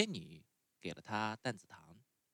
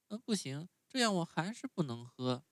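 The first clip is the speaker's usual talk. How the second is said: put-on much higher, whiny little boy; puppet boy voice; 8 semitones higher than usual. A narrator's reading. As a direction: sulk like a spoiled child refusing medicine. Pinocchio voiced in story.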